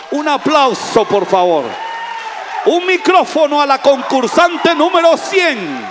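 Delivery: big shout to the whole room for applause; rally the crowd, game show host; loud call-out. Real, not acted. Preacher shouting to congregation for applause and a mic.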